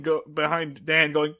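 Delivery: usual speaking voice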